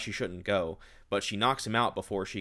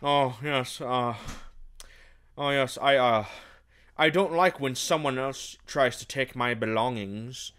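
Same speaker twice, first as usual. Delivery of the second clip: lightly voiced, slow smug villain; a hint of a creepy possessive lord; 3 semitones higher, 3 dB louder, slower than usual. Villain explaining his betrayal, slow smug put-on voice. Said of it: "he's just like".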